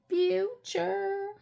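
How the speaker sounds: high, stretched-out cartoon "future"; SpongeBob impression. Budgeter quoting a cartoon for fellow fans, high drawn-out voice. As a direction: draw it out high like a cartoon catchphrase. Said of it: "If you're a SpongeBob fan, you know".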